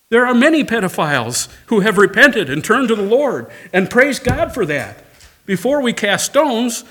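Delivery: fervent revival preaching; preaching at full force. Acted. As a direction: drive the warning home to the congregation. Climax of the sermon's appeal.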